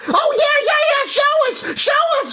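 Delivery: high, urgent, excited demands; eager demands. Acted. Kids demanding to meet the shoe plug, high eager urging.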